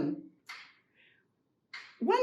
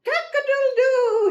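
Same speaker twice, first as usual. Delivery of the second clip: loud, high cock-a-doodle-doo; excited rooster crow; 12 semitones higher, 7 dB louder than usual. Acted. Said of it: "and all excited".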